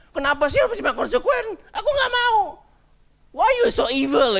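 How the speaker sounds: put-on high, loud child's tantrum; imitated angry yelling. Dad imitating his daughter protesting the consequence, angry yelling. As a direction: yell like an outraged little kid. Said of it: "dia teriak-teriak marah gitu".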